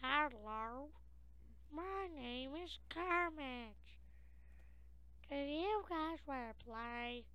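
high pitched voice changer puppet; Kermit voice changer. Pretend. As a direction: play Kermit through a voice changer. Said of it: "He was messing with the voice changer".

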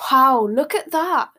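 loud, high mock amazement. A narrator's reading. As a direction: pretend to be astonished, swooping up high. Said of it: "Harry looked mockingly".

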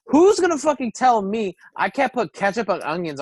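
loud, high, heated rant; getting loud. Real, not acted. Defending ketchup on hot dogs to his cohost, loud, high and heated. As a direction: pitch rising as he gets worked up. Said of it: "you're getting a little loud".

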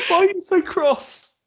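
high, loud, slow amazed question; cracking up at his anger; amused surprise. Real, not acted. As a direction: ask it in laughing disbelief. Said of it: "God, yeah, no".

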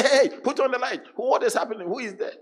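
high, loud frightened call for light; hammed-up panic in the dark; frightened shout. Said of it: "They say".

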